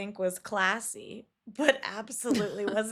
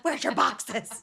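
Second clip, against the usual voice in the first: slow, mock-bossy demand, a little louder; bossy cookie boss; 4 dB louder, slower than usual. Friend playing troop leader demanding cookie boxes, mock-bossy. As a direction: play the cookie-season dragon barking at girls. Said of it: "brings up a dragon in me".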